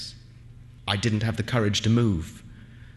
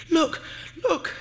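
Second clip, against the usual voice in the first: short, high-pitched cry; startled cry. A narrator's reading. Aminta drawing back her wet feet, high cry. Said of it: "she cried".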